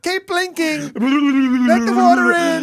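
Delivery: high, loud shout, slowing and stretched at the end; frantic command. Retold or imitated. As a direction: yell instructions over the crying, retold. Frantic rinsing, retold.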